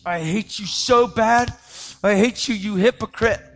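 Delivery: put-on girlfriend's angry outburst. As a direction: spit it out slowly like a hurt girlfriend.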